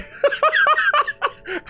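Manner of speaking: high-pitched burst of laughter; high, delighted cackle at own joke; laughing. Real, not acted. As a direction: crack up in a long, high laugh. Cracking up at sugar daddy joke.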